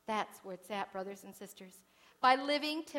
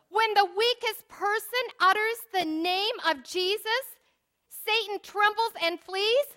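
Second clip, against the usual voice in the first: rising, louder preaching on Jesus' name; 8 semitones higher, 10 dB louder than usual. Acted. Preacher rouses congregation against Satan, fervent preaching. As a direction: build it up to a fervent proclamation.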